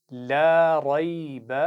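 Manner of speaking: drawn-out, melodic tajweed recitation; slow Quran recitation. Shown as a demonstration. Teacher reciting slowly for pupils to repeat. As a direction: chant each syllable slowly for the class to copy. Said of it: "एक दफ़ा फिर दोहराइए".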